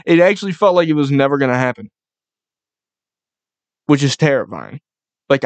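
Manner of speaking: ordinary delivery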